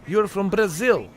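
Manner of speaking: light mock Portuguese accent on You're from Brazil. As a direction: tease her with a Portuguese accent. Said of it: "Don't talk like that".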